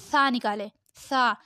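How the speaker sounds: touch tongue lightly to upper teeth for "سا". Shown as a demonstration. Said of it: "زبان touch کیجیے ہلکی سی".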